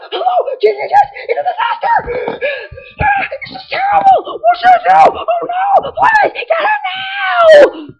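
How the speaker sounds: very high, loud frantic gasping; panicked Professor Elm. Retold or imitated. Professor Elm on the phone, shrieking in panic. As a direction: play the professor in full panic. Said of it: "he's freaking out for no reason".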